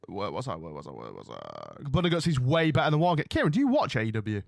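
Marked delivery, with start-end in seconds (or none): 0.1-1.8 each repeat drops much lower and quieter; sinking lower. Pretend. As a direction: let the voice sink to a growl as you fade.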